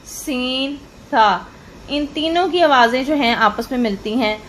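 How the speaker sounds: ordinary talk, nothing marked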